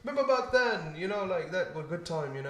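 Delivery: high, loud mock British accent; lightly hammed British accent, laughing. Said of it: "That's your British accent?".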